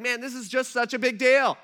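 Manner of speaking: high and loud, bowled over; amazed John. Retold or imitated. John in awe at how big the subject is, high and loud. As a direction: burst out in awe. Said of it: "he's just like".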